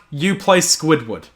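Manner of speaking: normal talking voice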